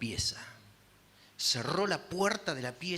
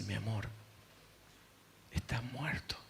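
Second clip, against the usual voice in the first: whispered, gentle; tender whisper; 3 semitones lower, 8 dB quieter than usual. Retold or imitated. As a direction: breathe it to him, barely voiced. Esposa llorosa habla al marido. (susurro tierno)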